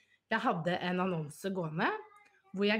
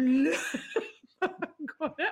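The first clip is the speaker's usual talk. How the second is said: words stretched slow through laughter; giggling; laughing; 8 semitones higher, 4 dB louder, much slower than usual. Real, not acted. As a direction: laugh it out slowly. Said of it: "jeg begynner å le".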